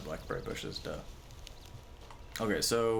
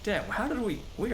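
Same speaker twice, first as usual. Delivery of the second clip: surprised; 12 semitones higher than usual. Real, not acted. Surprised by height.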